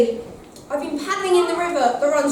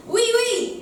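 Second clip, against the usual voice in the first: demo reply; 7 semitones higher than usual. Shown as a demonstration. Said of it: "you're going to shout back".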